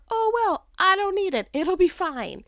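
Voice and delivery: high, breezy brush-off. Retold or imitated. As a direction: sound chirpily fine with it. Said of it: "You forgot to put ketchup".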